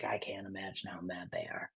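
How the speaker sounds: breathy hushed aside; whispered aside about their anger. Real, not acted. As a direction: drop to a whisper, as if letting us in on it. Aside about the trolled people.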